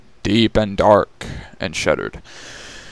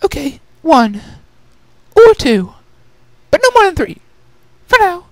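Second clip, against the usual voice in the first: much higher, bargaining with herself; bargaining lady; 5 dB louder than usual. Acted. Rarity haggling with herself over gems, higher and playful. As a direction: talk yourself into one more gem.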